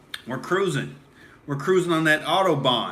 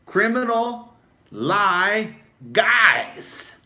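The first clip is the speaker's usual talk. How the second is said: very slow, higher, stretched sneer; drawn-out sneer; 6 semitones higher, much slower than usual. Acted. Host ranting at liars, very slow and higher. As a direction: stretch each word out in disgust. Mock rant podcast host.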